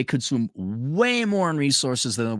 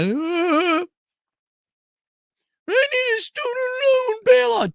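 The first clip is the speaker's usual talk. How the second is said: high whining fake cry; mocking a grad begging for a bailout; whiny mock young adult; slower than usual. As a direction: whimper it like a spoiled grad. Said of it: "they're like".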